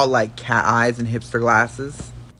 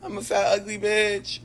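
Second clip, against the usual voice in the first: higher, slowed mock whine; mock skinny girl whine; 7 semitones higher than usual. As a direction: whine about being fat like a skinny girl. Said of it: "and be like".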